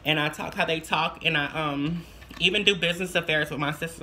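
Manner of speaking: usual speaking voice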